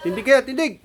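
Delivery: sharp, loud stand-up command; drill sergeant. Real, not acted. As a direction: bark it up high at the pigs.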